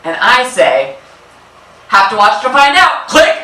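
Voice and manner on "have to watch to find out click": higher, louder teasing tease with a rising end; teasing reply. Retold or imitated. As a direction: tease Dad, letting the last word rise.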